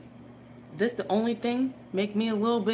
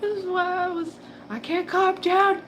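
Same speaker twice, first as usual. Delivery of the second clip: high; 10 semitones higher, 4 dB louder than usual. Retold or imitated. Imitating herself upset.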